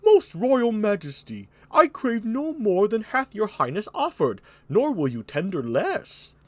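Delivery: Burgundy. Acted. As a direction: answer the king in a high, stately put-on voice. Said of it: "Here's Burgundy".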